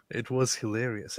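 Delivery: ordinary talk, nothing marked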